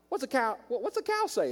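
put-on high sing-song question to a little kid; talking to a child. Adults asking little Owen a game question, high and sing-song. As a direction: ask it like you're quizzing a toddler.

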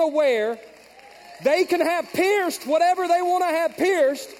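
high, loud, slow preaching; preaching at full force. Real, not acted. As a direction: drive it home from the pulpit. Preaching climax.

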